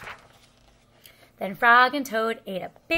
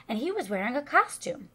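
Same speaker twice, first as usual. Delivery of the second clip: plain reading voice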